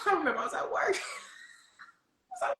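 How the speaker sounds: high voice breaking up with laughter; laughing. Real, not acted. Telling viewers a work story, helplessly laughing. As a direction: laugh through the words until they give out.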